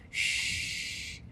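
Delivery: whispered shh; hushed shh. Acted. Squirrels hushing each other, whispered. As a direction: hush it in a whisper.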